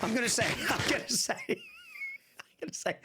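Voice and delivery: words broken by laughter, a bit fast; can't get the line out for giggling; laughing. Real, not acted. Streamer cracking up at his own joke to chat, laughing.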